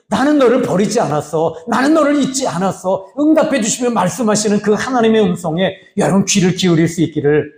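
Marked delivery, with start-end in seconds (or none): 0.1-3.1 higher, tender voice of God promising; God's voice, raised and tender. Acted. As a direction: speak as God comforting a child.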